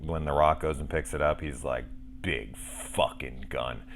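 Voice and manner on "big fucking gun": slow, low, drawn-out relish; savoring awe. Retold or imitated. Rock picks up the huge gun, slow low awe. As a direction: stretch each word out, low and weighty. Retold line of an awed gun grab.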